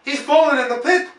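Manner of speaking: high, loud alarmed cry; cried out. A narrator's reading. Someone in the crowd cries out, loud and high. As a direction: yell it in alarm. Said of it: "cried someone".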